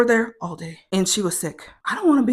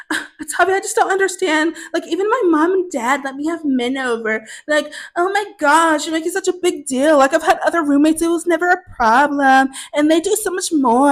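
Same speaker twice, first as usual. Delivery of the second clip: high, whiny put-on voice; mock petulant, high-pitched protest; childish roommate; 7 semitones higher than usual. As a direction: imitate a roommate complaining like a child. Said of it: "sounding like a child".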